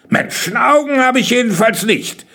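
high, impatient retort. A narrator's reading. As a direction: answer back with rising impatience. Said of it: "klang schon wieder ziemlich ungeduldig".

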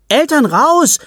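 high, loud chant-like shout; child shouting. Acted. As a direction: pound the table and shout. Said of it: "ruft Selin laut".

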